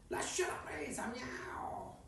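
high whispered cat voice; whispering cat. Acted. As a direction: hiss it like a secretive cat. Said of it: "fece quella voce gattesca".

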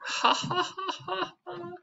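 hammed-up high ha-ha laugh; high mock laugh. Real, not acted. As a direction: laugh at your own joke, high and silly. Teacher laughs at own joke.

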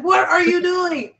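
high, loud retold yell; mock shout at the cat climbing the curtain; startled yell.

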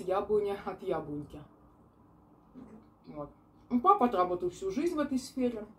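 speaking around a mouthful; words between chews; mouth full, chewing. Real, not acted. Seen 1: fork to mouth, bite at 1.6, cheeks full while speaking.

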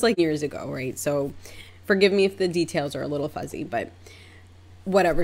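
usual speaking voice

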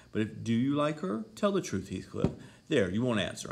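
high, loud interrogation; insistent demand. A narrator's reading. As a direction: press hard for an honest answer. Pressing him for the truth.

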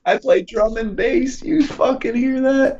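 high, hammed-up brag; mock excited brag. Bragging mock.

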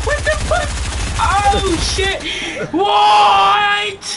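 loud outraged yell; raging gamer. Real, not acted. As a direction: yell at the screen.

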